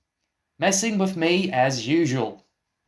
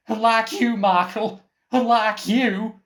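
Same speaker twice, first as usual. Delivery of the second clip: taunting retort; 7 semitones higher, 3 dB louder than usual. Acted. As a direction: rub it in with a sneer. Mocking retort in an argument.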